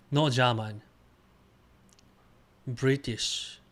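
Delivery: normal talking voice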